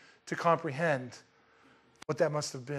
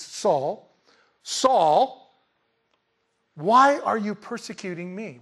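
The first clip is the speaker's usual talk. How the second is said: second "Saul" higher and louder than the first; emphatic repeated name; 4 semitones higher, 7 dB louder, much slower than usual. Shown as a demonstration. Preacher showing the Lord's emphasis on both names, emphatic and deliberate. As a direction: build on each "Saul", pausing between, then a strong "why are you persecuting me". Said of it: "It's emphasizing".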